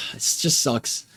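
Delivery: sighing, whispered and slow through tears; slow, breathy, tear-choked words; crying. Real, not acted. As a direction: let it out on a tearful breath. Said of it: "I don't know I'm crying".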